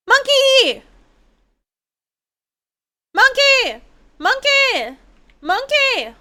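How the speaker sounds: high, loud shout of the word, falling at the end; calling out. Real, not acted. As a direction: yell after the monkey as it runs. Calling out at the monkey.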